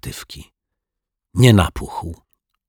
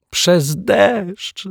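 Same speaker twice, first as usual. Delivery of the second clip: giggling; 13 semitones higher than usual. Acted. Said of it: "zachichotał detektyw Pozytywka".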